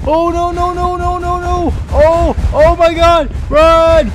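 high panicked screams of no; screaming in terror while chased; terrified screaming. Real, not acted.

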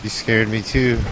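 normal talking voice

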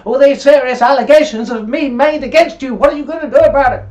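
high, loud, fast mock reporter voice; mocking the press. Mocking the press hounding a minister, high loud and fast. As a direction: ham up a pushy journalist firing questions. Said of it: "And then the press will go".

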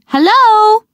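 bright sing-song greeting called out; calling out. A narrator's reading. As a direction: call it into the cottage. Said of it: "She called".

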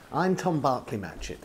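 ordinary delivery

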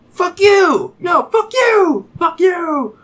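high, loud, drawn-out shouts; mock yelling back. Group answering the stranger's yelled insult, mock shouting.

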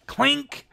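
high, playful "clink"; mock metallic sound effect; imitated trap clink. Imitates a trap snapping shut.

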